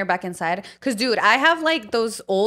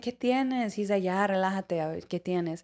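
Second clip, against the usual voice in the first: soft, low, calming father; a hint of a gentle father; gentle dad; 3 semitones lower, 7 dB quieter than usual. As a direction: soothe a crying daughter.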